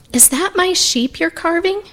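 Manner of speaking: put-on high, softened child's question; high-pitched, tentative child; little boy voice. A narrator's reading. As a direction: ask like a curious small boy. Boy asks the woodcarver timidly.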